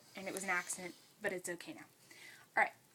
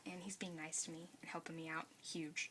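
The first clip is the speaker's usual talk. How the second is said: whispering; 4 semitones lower, 9 dB quieter than usual. Real, not acted. Aside about helpful brother.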